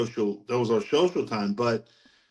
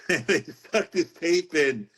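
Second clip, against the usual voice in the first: laughing through the words, higher and slow; 5 semitones higher, much slower than usual. Real, not acted. Man recalling his friend's cassette, laughing. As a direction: chuckle while telling it, drawn out. Chuckling as he retells the story.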